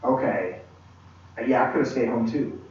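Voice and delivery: low, soft grudging acceptance; resigned. Retold or imitated. Speaker to himself about staying the course, quietly resigned. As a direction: concede it quietly, half to yourself. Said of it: "and I'm like".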